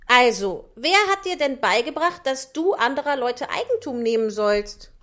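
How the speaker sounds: scolding glowworm. Acted. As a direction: lecture the thief firmly and loud.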